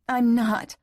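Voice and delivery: high, forceful repeated denial; insistent denial. A narrator's reading. Woman repeats denial to her lover, insistent. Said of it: "as she repeated".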